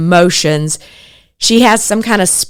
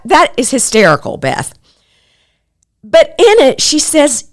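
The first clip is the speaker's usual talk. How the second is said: usual speaking voice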